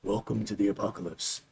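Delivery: low, soft, ominous bedtime story voice; mock spooky tale; spooky storyteller. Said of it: "This is how Beck talks".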